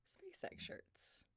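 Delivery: breathy secret whisper of SpaceX shirts; whispered aside. Real, not acted. Streamer whispering a cheeky aside to chat.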